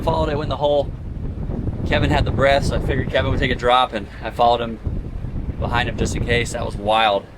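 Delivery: loud, high, thrilled; excited. Real, not acted. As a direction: still buzzing after the dive. Pumped up after the dive.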